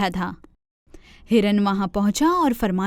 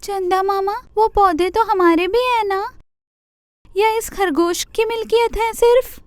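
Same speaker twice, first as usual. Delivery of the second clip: much higher pleading deer voice; complaining deer; 9 semitones higher than usual. Acted. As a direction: tattle to Chanda Mama. Deer appeals to Chanda Mama.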